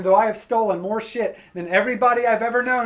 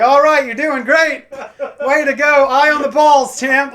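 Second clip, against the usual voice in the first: higher, louder, slow pep-talk cheer; coach voice; 6 semitones higher, 8 dB louder than usual. Pretend. Comic doing his coach voice during sex, loud and upbeat. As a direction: cheer her on like a sports coach. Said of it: "sound like a coach when I'm having sex".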